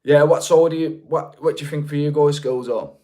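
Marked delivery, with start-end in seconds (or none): none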